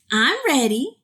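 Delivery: lightly voiced eager sister; declared eagerly. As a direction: announce it like a keen pupil. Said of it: "Rosa declared".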